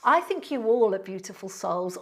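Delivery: ordinary delivery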